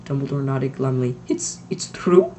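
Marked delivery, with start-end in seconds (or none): 1.3-2.3 slow, halting breathy falter. A narrator's reading. Strict witch admitting the rumour to the headmaster, faltering. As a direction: let the words falter. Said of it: "faltered Professor McGonagall".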